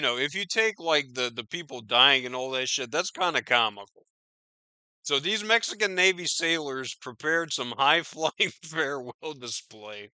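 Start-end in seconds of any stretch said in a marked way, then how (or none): none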